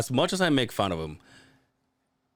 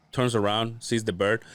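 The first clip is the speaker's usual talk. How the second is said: usual speaking voice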